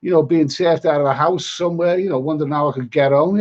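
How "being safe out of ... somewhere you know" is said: ordinary delivery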